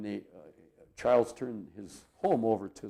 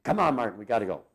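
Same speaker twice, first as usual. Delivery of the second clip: louder, lightly excited urging, imitating an eager friend; retold excitement; 8 dB louder, faster than usual. Charlie comes in to fetch Martin, imitated excitement. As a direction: hurry him along with a touch of excitement. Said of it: "he was all excited".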